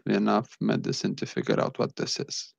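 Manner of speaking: ordinary talk, nothing marked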